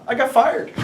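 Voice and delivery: high, loud punchline breaking into laughter; laughing admission. Real, not acted. Speaker confessing how his way ended, laughing. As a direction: blurt it out, tipping into a laugh.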